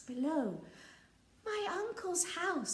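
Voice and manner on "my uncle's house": Pearl. Acted. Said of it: "said Princess Pearl".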